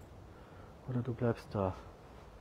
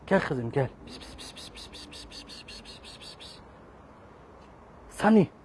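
calling out; 4 semitones higher than usual. Real, not acted. Calling kitten in Turkish.

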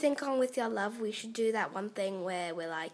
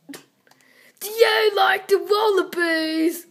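put-on loud, slow, high Australian accent; 5 semitones higher, 8 dB louder, slower than usual. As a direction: draw it out loudly in Australian. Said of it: "talking in an Au-, Australian accent".

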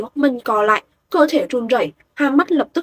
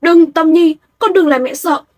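higher, much louder frantic pleading; panicked mother; 5 semitones higher, 6 dB louder than usual. A narrator's reading. Mother holding her hurt daughter, frantic pleading. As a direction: beg the child not to die, panicking. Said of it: "Cô ta như phát điên".

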